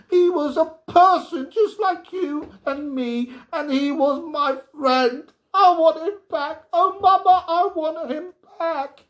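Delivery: high, loud desperate crying plea; a girl begging for her friend back; screaming child. A narrator's reading. Girl screaming, pleading in tears.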